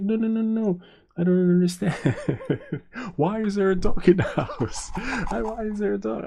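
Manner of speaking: high, loud protest breaking into laughs; mock a horrified wife while cracking up; imitated disbelieving squawk; aghast refusal.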